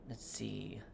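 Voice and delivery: soft whisper to herself; whispered aside. Real, not acted. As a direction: breathe it out to yourself while searching. Muttering to herself over the cards.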